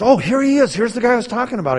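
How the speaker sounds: high, loud, eager, rushing the second line; bursting to introduce the guy; a retold husband, thrilled and loud; excited announcement. Husband excitedly hands over phone.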